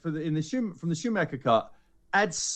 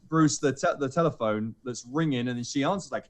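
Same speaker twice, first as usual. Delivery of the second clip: ordinary talk, nothing marked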